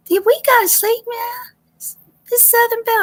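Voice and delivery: put-on a small girl just woken asks sleepily; little girl voice. Sleepy kids waking up, quoted.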